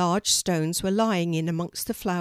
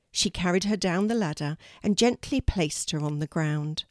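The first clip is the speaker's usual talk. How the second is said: ordinary delivery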